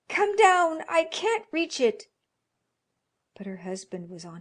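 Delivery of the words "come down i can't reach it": high, very loud strained shout; loud cry. Acted. Wife shouting up the stairs to her husband for help. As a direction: yell it up the stairs, panting. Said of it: "she cried loudly".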